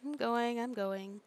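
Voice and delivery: ordinary talk, nothing marked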